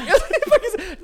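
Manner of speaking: shrill high-pitched burst of laughter. Real, not acted.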